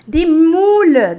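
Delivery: "De moule" said slowly and loudly, clear French "mul"; loud slow model word. Shown as a demonstration. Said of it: "teacher models French word". ("De moule" lento e bem alto)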